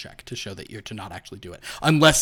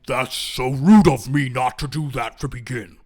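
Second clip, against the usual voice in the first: apologetic ghoul host, loud at first; over-courteous ghoul offering help; polite ghoul; 9 dB louder than usual. Acted. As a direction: gush an apology in a creature voice, then settle.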